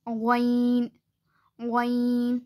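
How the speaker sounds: ग़ैन with a voiced gargled gh from the upper throat; ग़ैन: throat gh, long ai; Arabic ghain. Shown as a demonstration. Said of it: "हलक के ऊपर वाले हिस्से से ग़ैन अदा होता".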